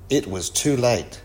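plain reading voice

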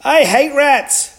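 very high, loud wail; storybook giant squealing in panic; giant's cry. A narrator's reading. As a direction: cry out in horror of rats. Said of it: "cried the giant".

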